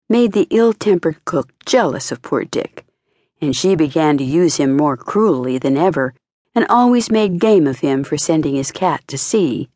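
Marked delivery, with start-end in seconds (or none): none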